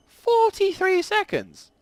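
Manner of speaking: high-pitched, drawn-out cry of disbelief; indignant, stretched-out exclamation; exasperated outburst. Real, not acted. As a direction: squawk it slowly, high and loud. Exasperated at long queue wait.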